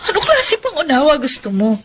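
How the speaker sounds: a bitter laugh breaking into tears. Acted. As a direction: laugh in disbelief, close to crying. Betrayed woman confronts ex-lover. (mapait na tawa na may iyak)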